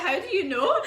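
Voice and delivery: high, loud astonished question; laughing, incredulous retort. Retold or imitated. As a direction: act out being startled at being recognised. Acted shock at being recognised.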